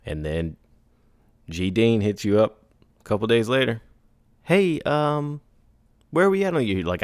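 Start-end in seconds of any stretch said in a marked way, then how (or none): 4.4-6.8 high, breezy follow-up voice; lightly voiced client. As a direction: chirp a casual check-in. Geraldine follow-up voice.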